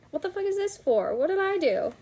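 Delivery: high-pitched, baffled indignation; indignant protest. Acted. Meg confronts Burr acting cold and distant, indignant. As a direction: act out a wounded, puzzled complaint.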